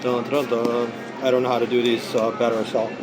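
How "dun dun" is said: normal talking voice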